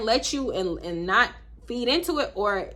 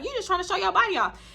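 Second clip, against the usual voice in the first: mock high quote; 9 semitones higher, faster than usual. Mocking the woman's remark.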